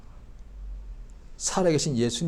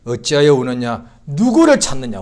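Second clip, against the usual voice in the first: louder voice of Jesus; 11 dB louder than usual. Acted. Jesus' question repeated.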